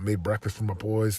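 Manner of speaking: usual speaking voice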